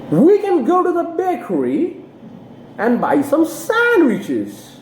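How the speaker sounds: high slow model reading, "bakery" falling and "sandwiches" rising; fall on bakery, rise on sandwiches. Shown as a demonstration. Teacher demonstrates old versus new information, slow and high. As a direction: drop the pitch on "bakery", lift it on "sandwiches". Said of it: "in bakery, your intonation has to fall".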